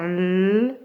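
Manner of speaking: L held long as "lll"; isolated L sound. Shown as a demonstration. Teacher asking where it is heard, long L. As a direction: lengthen the bare consonant L. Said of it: "où est-ce que j'entends L".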